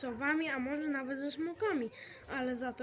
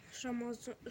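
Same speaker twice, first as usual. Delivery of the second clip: ordinary delivery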